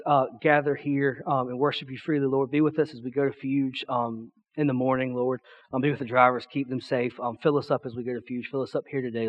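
low, soft, informal public prayer; hushed, low-pitched praying aloud. Real, not acted. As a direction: lead a congregation in quiet prayer. Leads congregation in prayer.

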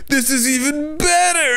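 high, slow, crying-sounding mock joy; delighted old man. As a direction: savour it like it's a miracle. Old man eats burnt fish.

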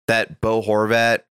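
usual speaking voice

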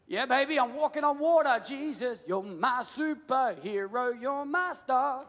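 high, giddy boast; a thrilled Peter calls out to Jesus; gleeful Peter. Pretend. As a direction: crow with delight like a kid. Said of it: "Peter goes from".

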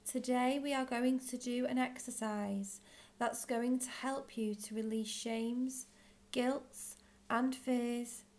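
usual speaking voice

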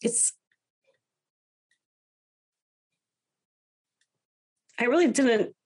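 real tears welling up mid-sentence; tearing up. Real, not acted. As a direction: voice catching, close to tears, apologising. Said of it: "Don't crying on podcasts".

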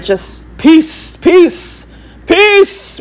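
high, rising repeated plea; mock blessing. Wishing everyone would be good, high mock blessing.

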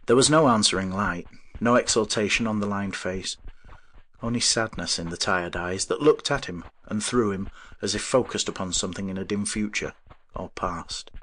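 ordinary delivery, nothing marked

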